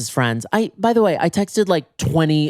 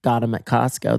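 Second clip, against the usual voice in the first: ordinary delivery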